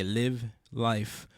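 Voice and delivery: normal talking voice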